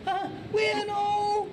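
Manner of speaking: very high-pitched, threatening Scouser; exaggerated Scouse. Shown as a demonstration. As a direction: squeal out menacing Liverpool slang. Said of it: "So here's, I think, a Scouser".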